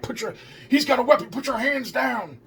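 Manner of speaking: urgent officer's command, imitated at normal volume; retold frantic police orders. Cops reacting to a supposed weapon, retold frantic orders.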